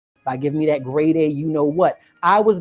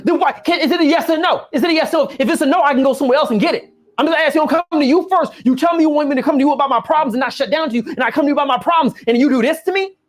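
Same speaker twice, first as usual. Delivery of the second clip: mock angry rant; 8 semitones higher, 3 dB louder, faster than usual. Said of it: "He goes off".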